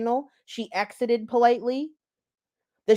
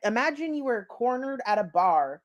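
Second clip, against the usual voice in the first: ordinary talk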